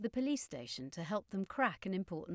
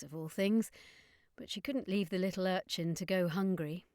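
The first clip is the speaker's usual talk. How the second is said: ordinary delivery, nothing marked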